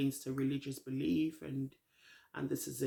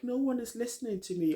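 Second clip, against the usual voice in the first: higher-pitched mild mock whine; mock upset; 7 semitones higher than usual. Host retelling Liv's tearful complaint, mildly mock upset. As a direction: lightly imitate an emotional complaint. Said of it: "she is crying. She gets super emotional".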